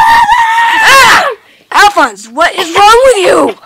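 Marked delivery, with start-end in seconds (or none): 0.0-1.4 sudden high yelp; startled yelp. Acted. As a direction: yelp in surprise. Startled by brother.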